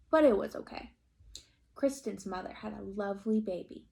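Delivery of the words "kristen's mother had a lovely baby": plain reading voice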